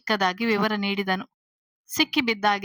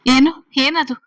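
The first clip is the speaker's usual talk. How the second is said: sharp, loud and high, demanding; alarmed loud question; 4 semitones higher, 10 dB louder than usual. A narrator's reading. Mother hearing a second voice in her daughter's room, alarmed loud question.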